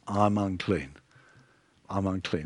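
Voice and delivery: very soft, low admission; hushed confession. Acted. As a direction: let it sink, barely voiced to yourself. Isaiah's confession before God, retold.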